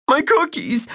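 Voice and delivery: high, anguished wail; distraught little boy wail. Acted. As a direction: cry it out like a heartbroken kid. Bunky wails about lost cookies.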